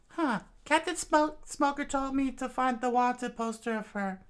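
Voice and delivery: high, realizing voice; high and startled; high character voice. Acted.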